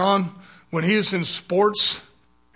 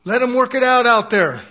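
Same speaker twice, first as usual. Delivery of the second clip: louder, higher, brushing it off; retold firm reply; 5 semitones higher, 7 dB louder than usual. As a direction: wave off the fuss with gusto. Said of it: "But I said".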